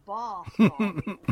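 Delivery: slow, drawn-out well-spoken "Bath" with a long "ah"; Derek's well-spoken accent. Retold or imitated. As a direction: mimic a well-spoken English accent, stretching "Bath, darling". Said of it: "and he'd say".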